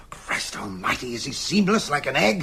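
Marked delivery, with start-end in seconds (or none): 0.1-1.1 gasping, hushed oath; stunned whispered oath; shocked whisper. Acted. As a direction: breathe it out in shock. Shocked gasp at the clue.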